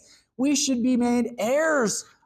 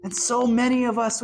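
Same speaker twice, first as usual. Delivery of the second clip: normal talking voice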